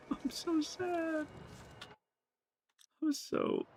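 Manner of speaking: high, slow, soft mock-pitiful whimper; mock sad whine. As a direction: whimper it like a hammed-up sad baby. Mocking chat's sad remark.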